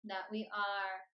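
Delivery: unhurried meditation voice with long pauses; guided meditation. Acted. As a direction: lead them gently into stillness, phrase by phrase.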